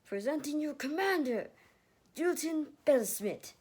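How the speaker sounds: loud, high herald announcement; announcing. Acted. Warrior announcing the commander, loud and ringing. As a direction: proclaim it to the whole camp. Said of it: "one warrior announced".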